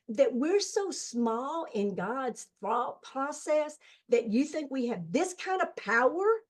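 re-enacting an insistent challenge to a nephew; emphatic challenge. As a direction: press the question, pitch and volume climbing. Retold challenge to frightened nephew.

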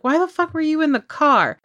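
incredulous retort. Retold or imitated. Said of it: "And I was like".

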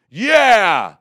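high, loud mock heckler; loud high jeering crowd voice; heckler yelling. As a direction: shout it like a rowdy heckler. Hecklers joining in.

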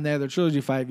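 ordinary talk, nothing marked

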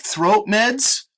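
normal talking voice

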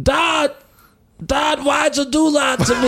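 put-on high, loud whiny Australian kid voice; a bitter son whining in an Australian accent. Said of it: "What accent is that?".

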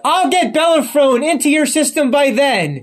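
high-pitched, urgent movie-hero plea; urgent promise. Acted. Acted urgent rescue promise.

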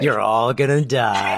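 slow, low playful threat; mock ominous. Host jokingly threatening all the listeners, mock ominous. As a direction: intone a doom-laden warning.

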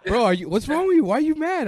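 high-pitched pestering questions; mock concern. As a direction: imitate someone needling an annoyed friend. Acting out pestering an annoyed friend.

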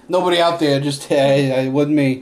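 mock pleading. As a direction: play the scared guy begging innocence. Mocking Dustin covering himself.

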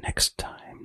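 a sudden hushed whisper; whispered teaser to listeners. Pretend. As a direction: drop to a secret whisper for the tease. Teasing the next episode, repeated.

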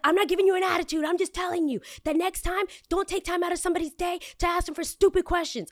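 high, snippy imitation of the clerk talking down to her; snippy postal clerk. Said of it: "She's like".

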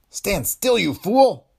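loud, high panicked order; yelling in a panic at the gun. A narrator's reading. Foster panicking as Dillon steps forward. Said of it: "There was panic in Foster's voice".

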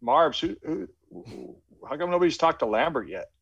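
sudden high-pitched "who", then puzzled; amazed nobody has scouted this kid yet; retold surprise. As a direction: jump way up in disbelief on the name. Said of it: "And I'm like".

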